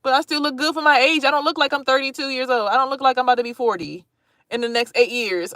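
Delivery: ordinary delivery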